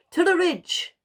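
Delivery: high, loud grand proclamation; walrus proclaiming. A narrator's reading. Mister Walrus proclaiming to Wilf, loud and high. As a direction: announce it chin up like a pompous walrus. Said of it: "proclaimed with his chin in the air".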